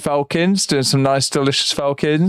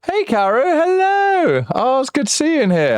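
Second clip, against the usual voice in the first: high-pitched voice; 12 semitones higher than usual. Shown as a demonstration. High-pitched greeting to chatter.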